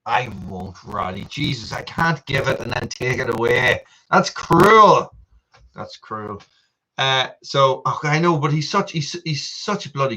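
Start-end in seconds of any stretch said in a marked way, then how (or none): 4.1-5.1 higher and louder, playful protest; mock outrage. 5.7-6.4 much softer, lower mock grumble; muttered aside. Repeats the protest quietly.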